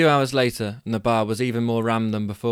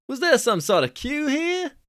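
Californian dude. Acted. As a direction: squeak up the pitch like a cocky Californian. Billy voiced.

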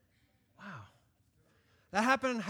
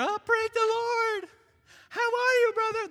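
churchy fake greeting; 8 dB louder than usual. Churchgoer greeting a brother after church, hammed-up pious. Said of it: "you go to church and you're".

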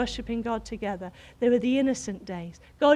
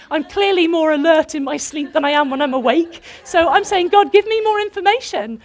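preaching; 5 semitones higher, 10 dB louder than usual. Acted. Preaching at full force.

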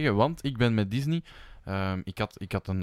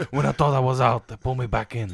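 Al Pacino; 5 dB louder than usual. Retold or imitated. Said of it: "ingesproken door Al Pacino".

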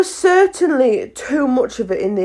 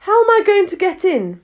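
put-on raised, higher girl's voice; louder; 9 semitones higher, 4 dB louder than usual. A narrator's reading. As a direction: call it out louder. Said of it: "she repeated aloud".

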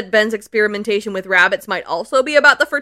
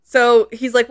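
ordinary delivery

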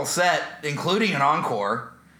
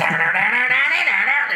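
loud, high mouthed guitar riff; imitated rock riff; 12 semitones higher, 7 dB louder than usual. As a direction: belt out the riff in nonsense syllables. Imitates the song's launching riff.

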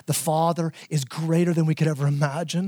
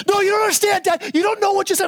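put-on high-pitched, louder child voice pleading its case; whiny kid protesting; 12 semitones higher, 4 dB louder, faster than usual. Child cutting off dad, high and loud protest. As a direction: play the kid who has already lawyered up.

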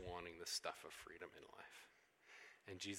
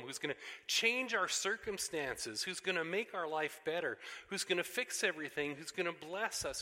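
loud, high, driving preaching; preaching at full force; 6 semitones higher, 7 dB louder than usual. Acted. Preacher rallying the congregation, loud and driving. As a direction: build the list like a rousing crowd.

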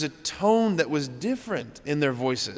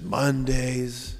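weary nurse's brush-off. Retold or imitated. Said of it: "That's what she said".

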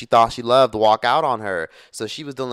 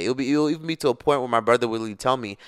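ordinary delivery, nothing marked

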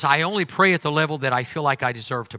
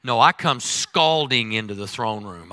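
ordinary talk, nothing marked

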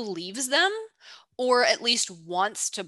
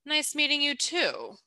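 a light hint of Abigail's knowing tone, higher; intrigued sidelong reply; 6 semitones higher than usual. Abigail replies to Ben while eyeing him, lightly imitated intrigue. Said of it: "replicate here is her tone of voice".

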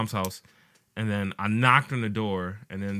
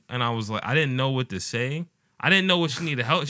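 usual speaking voice

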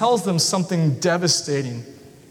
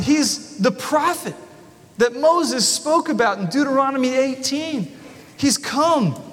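higher, eager crowd voice rising to a shout; excited crowd; 6 semitones higher than usual. Retold or imitated. Preacher voices the fed crowd cheering Jesus, excited and higher. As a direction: sell it like a hungry crowd that found its king.

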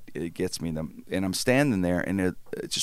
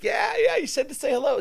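high-pitched, mock strained agreement; squeezed reply. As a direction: agree hastily in a high voice while being crushed. Acts his panicked reply inside bear hug.

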